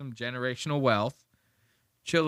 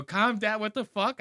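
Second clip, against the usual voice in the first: lightly voiced, higher exasperated Steve; mock exasperation; 8 semitones higher than usual. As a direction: play Steve fed up, a touch higher. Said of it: "I'd go".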